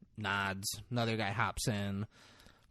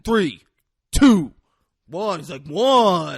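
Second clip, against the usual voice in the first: loud, high count, spaced out; acted countdown; 7 semitones higher, 13 dB louder, slower than usual. Cop ordering him to drop the gun, loud acted countdown. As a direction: call each number out like a threat.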